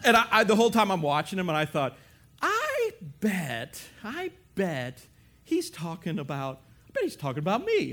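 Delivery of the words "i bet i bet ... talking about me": suspicious musing. Retold or imitated. Watcher at gym guesses he's being reported, suspicious. Acted suspicious inner thought.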